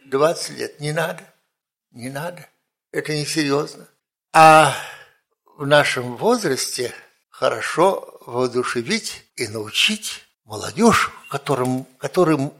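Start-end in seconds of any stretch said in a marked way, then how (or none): none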